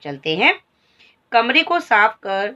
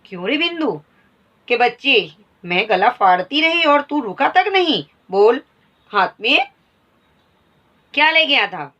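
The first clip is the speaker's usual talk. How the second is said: higher, louder angry scolding; furious scolding; 4 semitones higher, 4 dB louder than usual. Acted. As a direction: tear into the servant boy. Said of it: "बहुत क्रोधित होने लगी".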